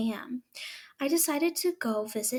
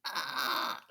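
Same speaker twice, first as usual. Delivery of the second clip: plain reading voice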